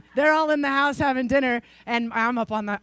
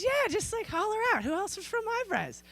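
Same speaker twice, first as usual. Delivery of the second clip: high, light voice for the friend; lightly voiced friend's urging; quoting a friend; 11 semitones higher, 7 dB quieter than usual. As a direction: chirp her urging line. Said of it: "She goes".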